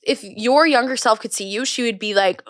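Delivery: ordinary delivery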